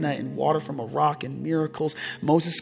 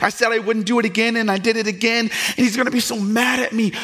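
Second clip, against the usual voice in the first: high, loud, impassioned pulpit delivery; full-force preaching; 9 semitones higher, 6 dB louder than usual. Acted. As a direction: preach it to the whole room at full voice.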